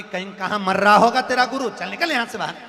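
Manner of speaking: loud, high scolding wife; acted shrewish outburst; angry yelling. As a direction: chase him out with a furious shout. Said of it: "पत्नी चिल्ला कर दौड़ी".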